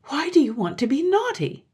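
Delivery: high-pitched, bewildered question; astonished mother. A narrator's reading. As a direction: ask in motherly amazement. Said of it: "said the mother in astonishment".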